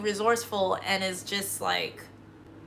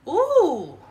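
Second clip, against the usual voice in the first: mock ooh; 7 semitones higher, 5 dB louder than usual. Real, not acted. Reacts to someone's "maga" comment.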